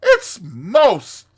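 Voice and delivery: high, loud, blustering Toad. Acted. Toad urges friends to help him, blustering. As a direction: insist boisterously at full voice.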